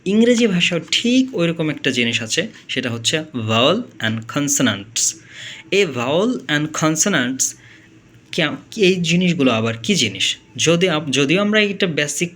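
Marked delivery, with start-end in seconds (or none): 3.2-7.6 vowel and consonants said very slowly; teacher introducing terms slowly; slow, careful. Shown as a demonstration. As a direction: drag out each word, vowel and consonants, to teach them. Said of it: "teaching new terms".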